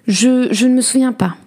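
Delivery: high, stammered wife's reply; flustered denial. A narrator's reading. Wife questioned by angry husband.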